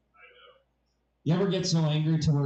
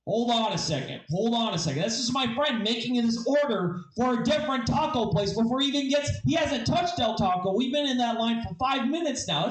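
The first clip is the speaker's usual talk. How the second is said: indignant objection; 7 semitones higher than usual. Acted. Acts phone guy's indignant objection.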